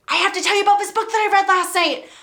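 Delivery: gushing fast to her mom about a book; excited rush. Retold or imitated. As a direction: blurt it out to mom, barely breathing. Excitedly retelling call to mom.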